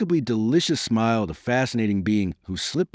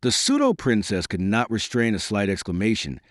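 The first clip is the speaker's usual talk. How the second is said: ordinary delivery, nothing marked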